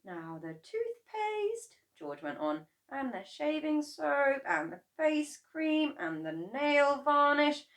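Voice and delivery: put-on high, slow boyish listing. A narrator's reading. George listing what goes in, high and slow. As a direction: tick items off like a boy. Boy George lists ingredients.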